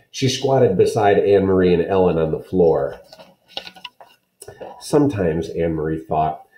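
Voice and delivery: usual speaking voice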